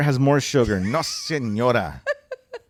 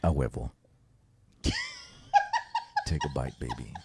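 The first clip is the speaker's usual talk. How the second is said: seductive praying voice; 8 semitones higher, 4 dB quieter than usual. Acted. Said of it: "he's saying it like this, like if he's praying".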